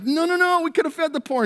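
high, loud scolding protest; indignant objection. Retold or imitated. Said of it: "There's just like".

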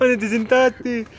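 drawn-out, high, loud question; mock dismayed yell. Joining in to scold the hapless friend, mock dismayed yell. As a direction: stretch it out in acted despair.

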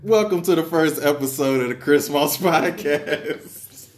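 hammed-up show announcer; podcast host intro. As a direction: open the show like a radio host.

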